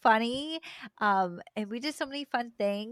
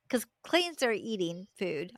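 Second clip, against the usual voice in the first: ordinary talk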